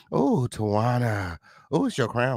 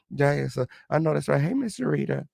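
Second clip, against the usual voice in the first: ordinary talk, nothing marked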